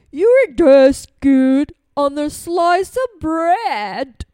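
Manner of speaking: loud, slow monster voice; big Gruffalo. Acted. Gruffalo tells the mouse he's food, booming slowly. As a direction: draw it out loudly like a hungry monster.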